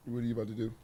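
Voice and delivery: gentle check-in with the little one. Real, not acted. Talking to his child on phone.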